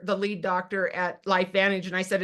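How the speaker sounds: ordinary talk, nothing marked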